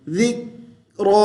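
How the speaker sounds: जिक्रा stopped on k, then full "raw"; heavy raa. Shown as a demonstration. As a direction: thicken and lengthen the end of जिक्रा. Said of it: "रा को पुर मोटी आवाज़ से".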